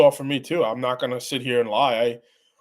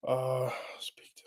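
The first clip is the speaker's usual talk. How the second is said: whisper; 12 dB quieter, slower than usual. Real, not acted. Reading chat under breath.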